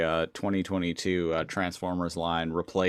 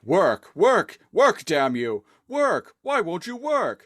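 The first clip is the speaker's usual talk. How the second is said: desperate rising pleas; begging the device to work; pleading with a machine; 10 semitones higher, 4 dB louder, slower than usual. Retold or imitated. Noble pleading with his harness.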